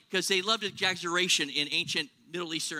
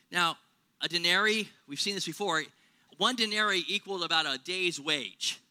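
normal talking voice

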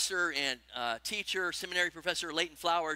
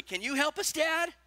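much higher, calling up the stairwell; the eldest child calling to dad, higher; shouted plea; 7 semitones higher than usual. Retold or imitated. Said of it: "He shouted".

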